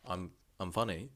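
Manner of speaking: flattered surprise. Pretend. Guy reacts to being called funny, softly flattered. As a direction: let the compliment catch you off guard.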